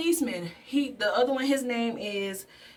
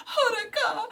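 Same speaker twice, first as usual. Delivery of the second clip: high, crying "Haruka!"; tearful cry of his name; 12 semitones higher than usual. Retold or imitated. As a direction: call his name through tears. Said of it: "And she's like".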